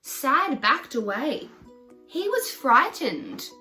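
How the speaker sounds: plain reading voice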